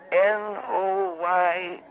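very slow, letter by letter; slow spelling. Acted. Weary caller spelling her name again, slowly. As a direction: spell it out with long drawn letters.

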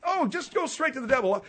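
high-pitched taunting echo; mocking. Host echoes Margaret's curse back at her, mocking.

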